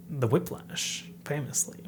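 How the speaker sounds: whispered knowing aside. Real, not acted. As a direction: drop to a sly whisper.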